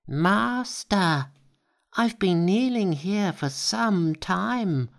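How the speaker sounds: high-pitched humble monkey voice; high eager Monkey. A narrator's reading. Monkey addresses his master.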